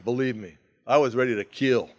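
ordinary delivery

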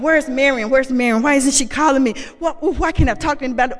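loud, frantic rush of questions; panicked inner voice. Retold or imitated. Frantic inner voice demanding to know why no one calls. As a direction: let the panic spill out loud and fast. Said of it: "I had these voices going".

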